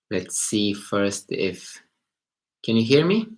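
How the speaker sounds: normal talking voice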